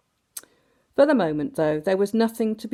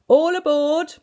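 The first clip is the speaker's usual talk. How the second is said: much higher, louder call to all; 9 semitones higher, 7 dB louder than usual. A narrator's reading. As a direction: call the crew aboard. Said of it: "called Mister Bumblebee".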